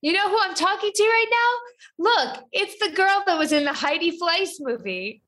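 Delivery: high mock British gentleman; retelling the Zoom guy in mock British; imitating British accent. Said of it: "heavy British accents".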